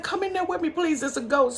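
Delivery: high, begging little voice; scared girl begging her mother to come see; pleading child. Retold or imitated. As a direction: beg mama to come with you.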